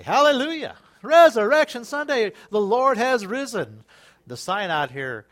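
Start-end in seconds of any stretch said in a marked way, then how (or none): 0.0-3.8 loud, high burst of praise; jubilant proclamation. Real, not acted. Preacher announces Resurrection Sunday to the congregation, jubilant proclamation. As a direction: proclaim it joyfully to the whole church.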